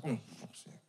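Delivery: under-the-breath fed-up mutter, nearly voiceless; exasperated mutter. Retold or imitated. Son muttering to mum, fed-up under breath. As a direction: sigh it out under your breath. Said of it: "I was like".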